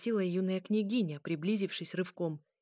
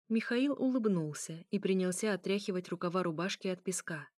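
ordinary delivery

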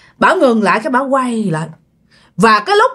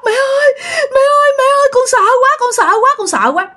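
high-pitched wail easing down at the end; frightened crying; 13 semitones higher than usual. Acted. Girl hugging her mother after the rescue, frightened crying. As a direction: wail like a terrified child clinging to mother. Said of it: "mới khóc quá trời khóc".

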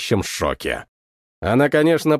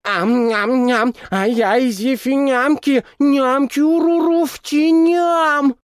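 high, slow cute munching babble; creature gibberish; 9 semitones higher, slower than usual. Acted. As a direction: babble like a hungry little creature. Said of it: "восторги на забавном языке оголодавших вейк".

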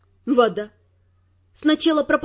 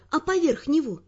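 normal talking voice

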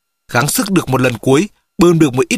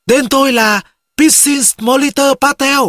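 high, slow call into empty space; last-breath cry; 9 semitones higher, slower than usual. A narrator's reading. Castaway shouts his name to test the echo, high desperate cry. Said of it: "Tôi gào vào không gian với những hơi thở cuối cùng".

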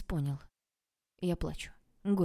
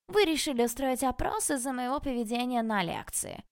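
mimicking her voice, pitched higher; mocking her words; 7 semitones higher, 3 dB louder than usual. A narrator's reading. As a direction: repeat her line back as a taunt. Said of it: "повторил он мои слова". (передразнивая её, выше)

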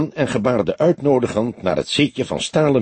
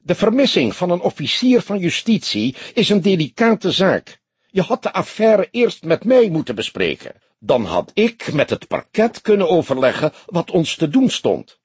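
high, put-on lecturing voice; indignant high-voiced reprimand; stern commissaris; 9 semitones higher than usual. A narrator's reading. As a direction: lecture a subordinate, pitched high. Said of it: "sprak hij streng".

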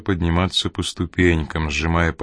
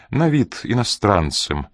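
plain reading voice

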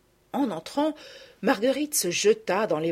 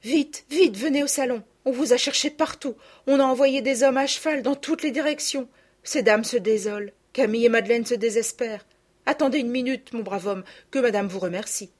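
higher and louder, urgent; flustered maid; 6 semitones higher, 5 dB louder than usual. Acted. Élisa rushes the lost girls to the salon, excited and urgent. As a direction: hurry them inside, all in a fluster.

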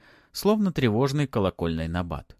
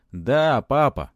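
loud, higher cheeky reply; brazen; 5 semitones higher, 7 dB louder, much slower than usual. A narrator's reading. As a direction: bluff it out boldly. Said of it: "нагло ответил Матвей".

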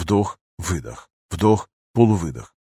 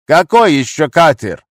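high, loud, slow question; harsh demand; 6 semitones higher, 7 dB louder, slower than usual. A narrator's reading. Gunman questioning captive about another boat, loud demand. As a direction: demand an answer forcefully.

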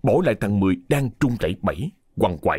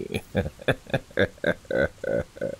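low evil chuckle; 7 semitones lower, 5 dB quieter than usual. A narrator's reading. Master's menacing laugh.

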